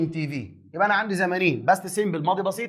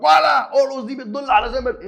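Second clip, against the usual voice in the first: higher-pitched mock eager student; raised-pitch parody of an old-school learner; student blurting a rule; 7 semitones higher, 5 dB louder than usual. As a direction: imitate a student jumping on a keyword. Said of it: "يقول لك".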